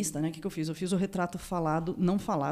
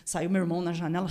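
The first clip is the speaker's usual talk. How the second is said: ordinary talk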